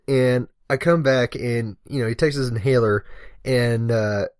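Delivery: normal talking voice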